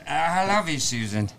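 lightly voiced English comic actor; put-on English accent, starting high and dropping; Dudley Moore. Shown as a demonstration. Host showing his only English accent, comic English actor. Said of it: "it's only Dudley Moore".

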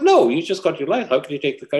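high-pitched protesting mother, acted; mother's objection. Mother to newly licensed son who wants the car, high-pitched acted objection.

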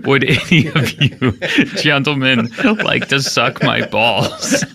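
put-on French accent cracking up with laughter. Co-host saying the requested crude line, laughing French accent. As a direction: struggle through a French accent, giggling. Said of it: "give me English words, but the accent".

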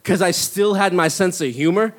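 normal talking voice

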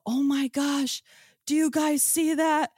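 high, excited call to friends; startled call. Retold or imitated. Calling friends over to see the orb, high and amazed.

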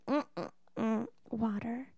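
mock baby-talk girlfriend mumbling water; baby-talk whimper. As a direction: whimper it like a sulking toddler. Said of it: "And she's like".

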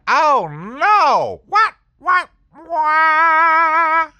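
very high, loud, stretched wail; hammed-up horrified shriek; shocked outcry. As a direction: cry out in disbelief. Comic shock at bad news.

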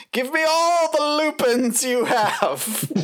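high, slow, laughing bandit demand; highwayman voice. Retold or imitated. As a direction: ham up a gallant robber. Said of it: "Brickbag is basically Dennis Moore".

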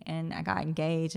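ordinary talk, nothing marked

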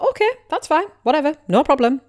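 high-pitched, dismissive brush-off; airy retold "suit yourself" tone; mock nonchalance. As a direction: act as if the rejection doesn't matter. Retells brushing off a rejection.

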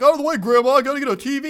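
high, very loud mock yell; hammed-up impatient shout. Grandson shoving Grandma aside for a TV, mock impatient shout.

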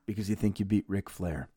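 low, soft aside; imitating a wrestler's sudden hush after a cry; back low. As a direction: drop right down, low and quiet. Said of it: "back low".

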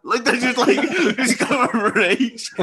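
can barely get it out for laughing. Real, not acted. Laughing at the story.

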